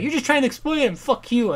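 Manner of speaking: put-on high, loud, snippy girl voice; mock furious daughter; angry daughter. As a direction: snap back at the father, hammed up. Daughter's retort.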